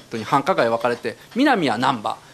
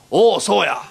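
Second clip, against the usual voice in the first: Fukada's reply; 2 semitones higher, 4 dB louder, slower than usual. Retold or imitated. Fukada doubling down.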